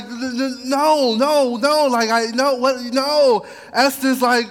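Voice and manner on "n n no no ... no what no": high, loud stammering denial; panicked Haman. Retold or imitated. Haman caught out before the king, panicked and loud. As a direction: sputter out frantic noes.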